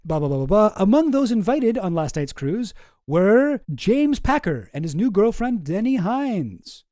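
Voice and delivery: usual speaking voice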